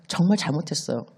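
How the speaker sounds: put-on soft, sorry child's apology; quiet apology. Child confessing the broken vase to father, quiet and sorry. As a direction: say it small and ashamed.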